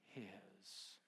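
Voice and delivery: soft, hushed his; barely voiced tender ending. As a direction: drop his to near silence. Hushed emphasis.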